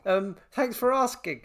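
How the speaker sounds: high, mock-casual reassurance; a hint of a nervous patient; nervous false calm. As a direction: act fine while clearly rattled. Answering the doctor's worrying call.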